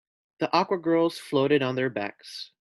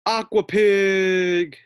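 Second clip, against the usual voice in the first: slow, loud, drawn-out announcer call; announcer's big reveal; 7 semitones higher, 8 dB louder, much slower than usual. Acted. Announcer booming the star's name to the crowd, drawn out and loud. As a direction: stretch it out like a showman presenting a star. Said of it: "Put your hands together for the one and only".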